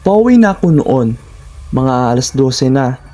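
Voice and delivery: plain reading voice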